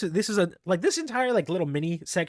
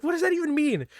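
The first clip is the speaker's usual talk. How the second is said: baffled; 13 semitones higher than usual. Retold or imitated. Baffled by the catfish trap.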